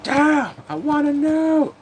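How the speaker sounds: high, loud, drawn-out comic wail; exasperated outburst. Acted. Streamer dying to know the ending, high exasperated wail. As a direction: wail it high and slow, desperate to find out.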